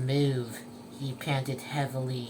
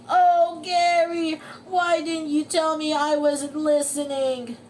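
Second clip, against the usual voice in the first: high, loud remorseful wail; SpongeBob wailing; 10 semitones higher, 5 dB louder than usual. Acted. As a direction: lament to your pet. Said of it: "wailed SpongeBob".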